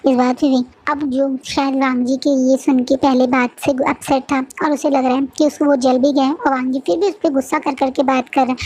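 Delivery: ordinary delivery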